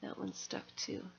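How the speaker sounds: whispered to herself; unvoiced aside while working. Real, not acted. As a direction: breathe it under the breath. Muttered to self.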